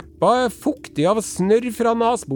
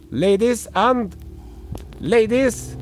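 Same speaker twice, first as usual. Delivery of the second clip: hammed-up English court herald; pompous herald; 6 semitones higher than usual. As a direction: announce the king to the court. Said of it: "og så sier han".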